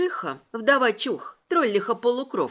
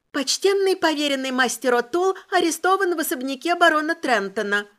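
higher, louder newsboy cry; newsboy shout; 6 semitones higher, 3 dB louder than usual. Acted. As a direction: shout it like a paper boy. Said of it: "тоном мальчишки-газетчика прокричала я".

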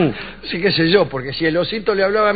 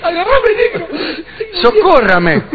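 mock fright; 12 semitones higher, 4 dB louder than usual. Pretends fright of monster.

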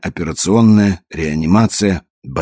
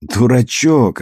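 higher-pitched, lightly amused exclamation; mild playful scoff, pitched up; amused teasing; 7 semitones higher, 4 dB louder, slower than usual. A narrator's reading. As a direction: tease him with a hint of laughter. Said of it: "рассмеялась она".

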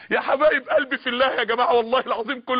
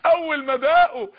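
tearful cry; 4 semitones higher, 4 dB louder than usual. Real, not acted. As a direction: voice breaking, louder and higher. Preacher voice breaks. (صوت مكسور بالبكاء)

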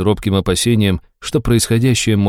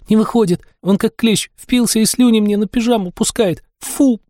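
high, panicky complaint; a touch of panic; 14 semitones higher than usual. Acted. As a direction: squeal it in mild panic. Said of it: "С ноткой паники".

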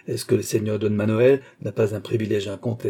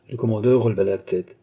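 ordinary delivery, nothing marked

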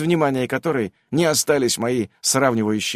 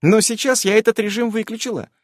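a touch higher, explaining; higher-pitched explainer voice; lightly voiced magic companion; 9 semitones higher than usual. A narrator's reading. Nasa explains the mode to the player, lightly voiced higher. Narrator voices Nasa's line.

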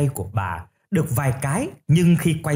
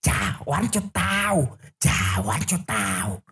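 slow, distant distorted ghost cry; possessed wailing; 2 semitones higher, 4 dB quieter, slower than usual. A narrator's reading. Possessed grandmother runs out demanding revenge, slow eerie wail. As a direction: stretch the words out, haunting and softer. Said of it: "vừa chạy vừa hét lên".

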